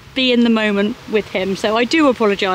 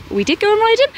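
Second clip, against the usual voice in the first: mock disbelief; 10 semitones higher, much faster than usual. Echoes own line as question.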